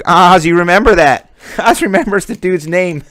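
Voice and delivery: said through real laughter; laughing. Real, not acted. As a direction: laugh while getting the words out. Laughing at a friend's joke.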